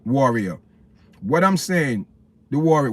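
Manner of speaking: ordinary delivery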